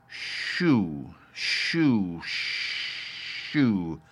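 "Shoo, shoo, shoo" low and soft, fading to a breath; drawn-out shoo. Shown as a demonstration. Father sounding out the word for Taffy, fading away. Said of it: "the O noise runs out all thin".

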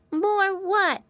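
high squeaky little pig; a puzzled piglet asking; piggy boy voice. A narrator's reading. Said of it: "asked Flop".

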